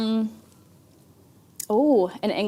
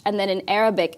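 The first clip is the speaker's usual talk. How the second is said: ordinary delivery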